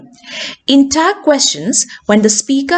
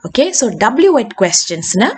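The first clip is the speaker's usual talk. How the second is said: ordinary talk, nothing marked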